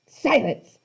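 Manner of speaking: snarled. A narrator's reading. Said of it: "Medusa snarled".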